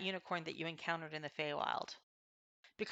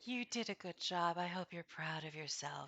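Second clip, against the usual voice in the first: softer, gentle unicorn; tender unicorn voice; 8 dB quieter than usual. Acted. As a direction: praise them like a kindly unicorn.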